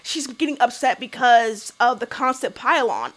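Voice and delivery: usual speaking voice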